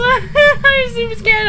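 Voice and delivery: high, slow nervous laugh through it; laughing scared. Real, not acted. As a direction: laugh while admitting fear. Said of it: "I am super scared now".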